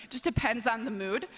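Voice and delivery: ordinary delivery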